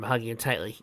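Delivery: plain reading voice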